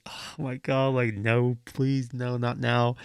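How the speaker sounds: softly retold dismay; quiet dread. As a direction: plead under your voice, half to yourself. Acted inward plea at friend's admission.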